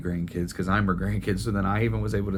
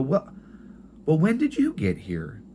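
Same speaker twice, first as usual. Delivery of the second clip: higher, light imitation of a confused grandma; grandma's voice; 7 semitones higher than usual. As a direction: lift the voice into a puzzled old mother. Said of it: "and she'd go".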